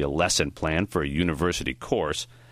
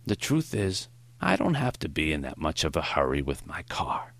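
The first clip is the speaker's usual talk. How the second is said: put-on Maury, gentle old teacher. A narrator's reading. Old teacher telling his student about traffic, gently instructive.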